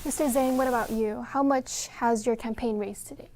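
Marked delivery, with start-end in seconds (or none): none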